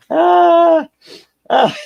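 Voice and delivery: squealing with laughter; can't stop laughing, pitch way up; high laughing. Real, not acted. Laughing hard at the text he sent his friend.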